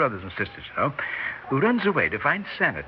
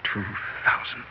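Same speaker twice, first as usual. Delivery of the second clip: scheming murmur; 3 semitones lower than usual. Acted. Con man musing on sum.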